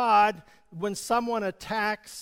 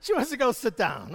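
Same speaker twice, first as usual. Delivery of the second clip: laughing; 7 semitones higher, 4 dB louder than usual. Real, not acted. Laughing at volunteer leaving.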